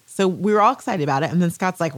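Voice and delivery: ordinary talk, nothing marked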